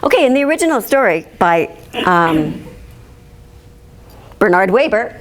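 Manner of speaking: ordinary delivery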